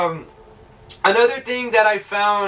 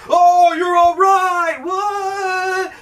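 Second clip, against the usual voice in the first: slow, loud, high drawn-out cheer; hammed-up high holler of joy; mock excited friends; 12 semitones higher, 4 dB louder, much slower than usual. Friends bursting in on the hero, mock excited yell. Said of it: "his friends burst in and they're like".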